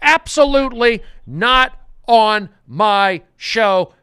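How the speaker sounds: loud, high, worked-up insistence; fired-up rant. Real, not acted. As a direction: shout it like a riled-up host refusing defeat. Host ranting at quitting caller.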